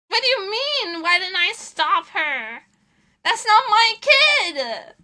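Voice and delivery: loud, high, drawn-out outrage; indignant protest. Acted. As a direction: throw the blame back, stretching the words. Indignant retort to absent parent.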